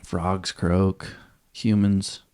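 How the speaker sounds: usual speaking voice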